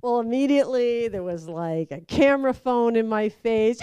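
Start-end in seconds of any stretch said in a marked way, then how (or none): none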